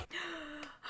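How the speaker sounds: sharp whispered gasp of delight; excited gasp. Real, not acted. Vlogger sees the book picks, whispered gasp.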